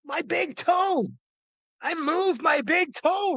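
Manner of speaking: high, drawn-out triumphant yell; exultant, stretched-out shouting; retold scream. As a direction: announce it slowly, way up high. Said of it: "I scream".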